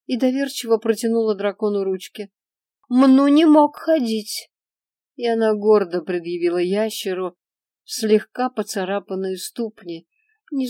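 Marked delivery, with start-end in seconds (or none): 2.9-4.5 put-on high, loud, slow childish whine; whiny child voice. A narrator's reading. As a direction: wail it like a pouting little girl. Girl complains to dragon.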